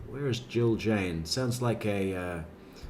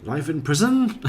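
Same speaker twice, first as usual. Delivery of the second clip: PRISON pitched up as a question; mock answer, "prison" rising high; weird upward inflection; 4 semitones higher, 4 dB louder than usual. Mocking Chris Watts's answer, "prison" oddly lifted. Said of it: "He does this weird up inflection thing at the end".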